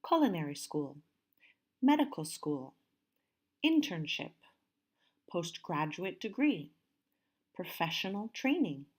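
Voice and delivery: deliberate word list, stressed syllables brought out; careful model pronunciation. Shown as a demonstration. Teacher says listen how I pronounce them, careful stressed model. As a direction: read each term carefully, marking its stress. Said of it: "listen and see how I pronounce them".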